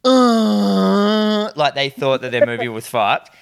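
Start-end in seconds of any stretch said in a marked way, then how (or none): 0.0-1.5 long, loud, high drawn-out "uh"; mock wail of an upset crowd; collective cinema groan. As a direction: imitate a whole audience groaning in dismay. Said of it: "everyone in the cinema went".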